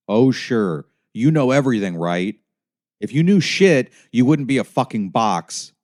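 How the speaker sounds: loud, high-pitched sarcastic outburst; angry sarcasm. A narrator's reading. Driver losing his temper at his GPS, angry sarcasm. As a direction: blow up at a know-it-all, loud and high.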